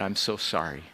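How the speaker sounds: retold sobbing apology.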